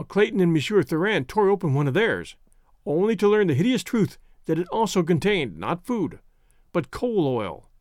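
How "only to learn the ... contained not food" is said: plain reading voice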